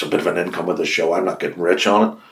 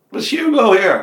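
caller on the phone; 7 dB louder than usual. Retold or imitated. Caller announces himself on phone.

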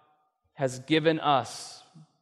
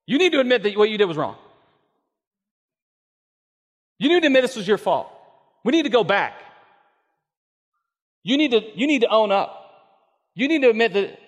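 higher, louder, fast accusing demand; 7 semitones higher, 7 dB louder, faster than usual. Retold or imitated. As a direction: hammer every need at them. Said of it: "we go".

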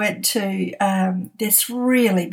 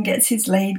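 ordinary talk

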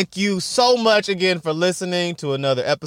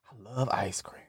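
unvoiced whispered confession; 7 semitones lower, 14 dB quieter than usual. Real, not acted. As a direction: whisper it dreamily. Whispered love of ice cream.

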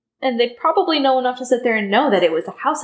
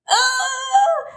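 high, drawn-out mock dithering; flustered official; 12 semitones higher, slower than usual. Fudge fending off Harry's prisoner question, comically flustered. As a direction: imitate an official squirming out of a question, pitched way up.

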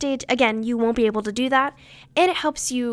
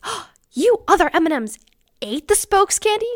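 high, loud, shocked gasp of a line; acting surprised; 7 semitones higher, 4 dB louder than usual. Shown as a demonstration. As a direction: play up the shock for the judges. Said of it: "Maybe act so surprised".

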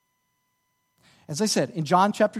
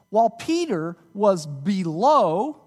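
"below" drawn out and louder; stress on below; 4 dB louder, slower than usual. Shown as a demonstration. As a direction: read slowly, landing hard on "below". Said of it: "I emphasized that word below".

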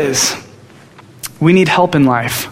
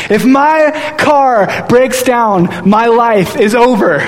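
high, slow, half-laughing panic; mock the dread of a ruined life; panicked outburst; 6 semitones higher, much slower than usual. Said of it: "I began to say, these words come out of my mouth".

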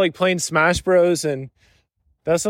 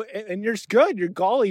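ordinary talk, nothing marked

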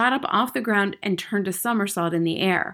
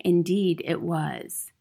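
usual speaking voice

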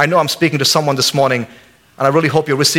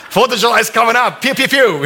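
mock gunshots; 7 semitones higher than usual. Mock redneck firing guns.

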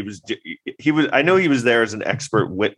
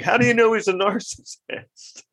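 high, rising challenge; lightly hammed-up demand for proof; mock questioning; 10 semitones higher than usual. Court questioning an expert witness, mock incredulous.

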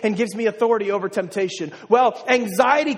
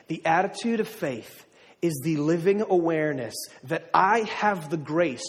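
slow and deliberate, for note-taking; slow dictation; 5 semitones lower, 4 dB quieter than usual. Shown as a demonstration. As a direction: let them write it down word by word. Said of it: "If you wanna write something down".

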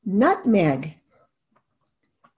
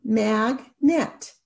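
mag-net, each syllable tapped apart; 2 semitones lower than usual. Shown as a demonstration. Teacher tapping out syllables for students, slow and separated. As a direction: tap out "Magnet" slowly, syllable by syllable. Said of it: "Let's tap it out".

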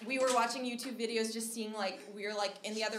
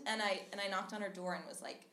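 normal talking voice

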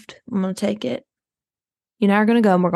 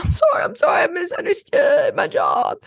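shrill, much higher panicked sorry; frantic apology; 9 semitones higher than usual. Retold or imitated. Maid apologizing to mother after tripping the blindfolded boy, frantic. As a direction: blurt it out high and flustered. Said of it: "And she was like".

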